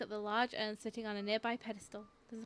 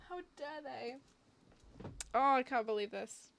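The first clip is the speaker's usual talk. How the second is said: ordinary talk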